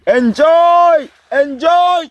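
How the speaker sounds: very high falsetto calls; gleeful whooping. Pretend. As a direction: whoop it out to the kids. Whooping with the nephews.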